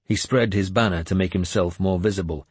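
usual speaking voice

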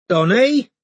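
high, loud questioning call. A narrator's reading. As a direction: call his name outside. Neighbour calling from balcony.

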